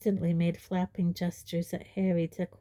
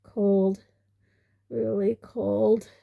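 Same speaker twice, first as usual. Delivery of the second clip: croaking; 2 semitones higher, 4 dB louder, much slower than usual. A narrator's reading. Dudley croaking, shivering.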